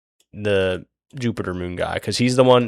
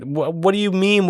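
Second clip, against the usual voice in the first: higher, mock-innocent stammer; feigned innocence; 10 semitones higher than usual. Rock acting innocent to the Jupiter guy, higher mock stammer. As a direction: play dumb, voice pitched up. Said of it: "Rock's like".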